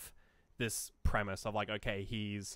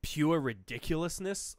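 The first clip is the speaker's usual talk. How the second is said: slow, louder, higher exasperation; exasperated; 5 semitones higher, 7 dB louder, slower than usual. Real, not acted. Ranting to a co-host about the film, exasperated. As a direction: lean on how absurd it is. Mocking the suit guy.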